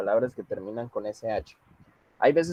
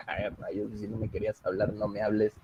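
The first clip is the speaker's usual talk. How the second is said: mock muttered complaint; 3 semitones lower, 9 dB quieter than usual. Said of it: "todos la dicen así como".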